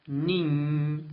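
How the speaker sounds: nin blended as one drawn syllable; sounding out. Shown as a demonstration. Teacher blending sounds for students. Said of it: "unimos esas tres".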